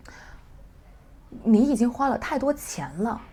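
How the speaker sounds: complaining tone in 你已经花了太多钱了; 抱怨的语气. Shown as a demonstration. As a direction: grumble it like scolding a spender. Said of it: "I'm using a complaint intonation".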